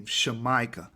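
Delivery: "Shamaika" with sh, sha-MY-ka; correct pronunciation. Shown as a demonstration. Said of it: "That's how you're supposed to say it".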